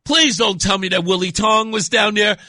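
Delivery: loud, pained groan of dismay. Retold or imitated. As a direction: cry out in mock despair. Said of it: "I'm like".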